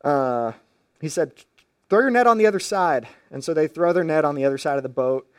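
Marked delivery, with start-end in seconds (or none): 1.9-3.1 high, louder, quick call. Retold or imitated. Stranger on shore to fishermen, calling out. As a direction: call the instruction out briskly.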